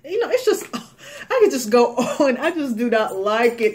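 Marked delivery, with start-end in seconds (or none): 0.7-1.2 breathy little laugh; quick airless chuckle; soft laugh. Real, not acted. Laughs at own rant. 1.3-3.7 louder, fast, laughing through the words; amused rant with laughter. Real, not acted.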